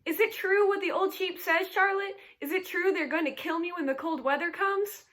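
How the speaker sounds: high, loud, fast panicked pleading; frantic screaming. A narrator's reading. Pig frantically begging the spider for the truth. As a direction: scream then beg for the truth. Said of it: "screamed Wilbur".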